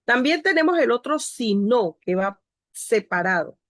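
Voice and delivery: normal talking voice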